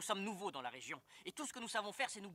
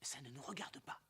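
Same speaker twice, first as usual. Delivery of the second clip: whispering; 7 semitones lower, 9 dB quieter than usual. Acted. Overheard rebels, whispering.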